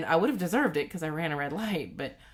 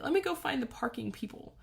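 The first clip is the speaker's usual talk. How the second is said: upset and tearful; 12 semitones higher than usual. Retold or imitated. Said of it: "I'm just sobbing uncontrollably".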